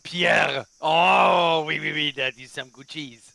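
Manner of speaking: mock French accent, loud; hammy Frenchman savoring cheese. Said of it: "they're the French".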